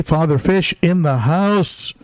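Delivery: high, drawn-out shout-out; big welcoming hype call; announcing a guest. Real, not acted. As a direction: hail an honored arrival, stretching the words. Hailing a guest in chat.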